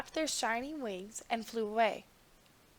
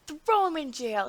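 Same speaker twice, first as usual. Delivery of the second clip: loud, higher furious order; angry roar; 4 semitones higher, 7 dB louder than usual. A narrator's reading. Angry king roaring the order at the guards. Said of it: "he roared".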